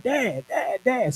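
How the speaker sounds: high, eager calls of Dad; little girls running up calling for Dad; excited calling out. Retold or imitated. Daughters calling to their father.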